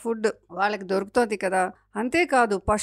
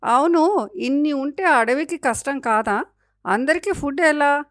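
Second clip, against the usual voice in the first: higher, louder little monkey voice; questioning baby monkey; 6 semitones higher, 5 dB louder than usual. A narrator's reading. Baby monkey asking forest mother how all get food, questioning. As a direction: ask eagerly as the baby monkey.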